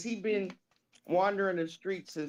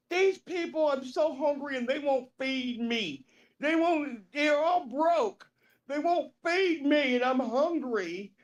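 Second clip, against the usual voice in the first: high plaintive complaint; Roger impression; 7 semitones higher than usual. Homeless man complaining nobody feeds him, high whining impression. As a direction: whine it high, stretching the ends. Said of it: "Roger talks like this".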